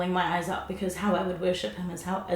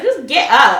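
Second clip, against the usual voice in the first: much louder punchline, laughing; loud laughing command; 7 semitones higher, 16 dB louder, slower than usual. Retold or imitated. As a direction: deliver it as the big punchline. God's punchline, repeated.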